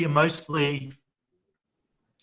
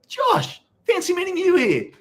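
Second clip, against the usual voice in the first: much higher, laughing mock surprise; mock Paddy greeting; 4 dB louder than usual. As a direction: ham up a fake surprise meeting. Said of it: "he turns up and he goes".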